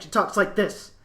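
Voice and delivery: tight-bellied, clipped samurai delivery; a touch of the tense movie-samurai speech. Shown as a demonstration. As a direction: squeeze it out from a tight abdomen like a stern samurai. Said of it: "this is how she talks".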